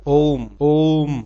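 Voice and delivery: chant-like long ओम; ओम held out very slow; prolonged Om. Shown as a demonstration. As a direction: draw out the ओ of ओम slowly, three beats long. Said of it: "जिनके उच्चारण में तीन गुना समय लगता है".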